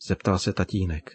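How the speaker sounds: usual speaking voice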